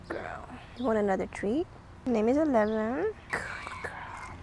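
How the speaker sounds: soft whisper to a dog. Real, not acted.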